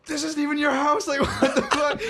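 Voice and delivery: very high, loud, laughing mock outrage; laughing disbelief. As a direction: call out her lie through laughter. Guy turns on girl, laughing.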